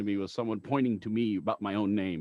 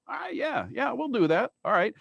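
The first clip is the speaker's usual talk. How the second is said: owner's voice; 10 semitones higher than usual. Retold or imitated. Station owner agreeing.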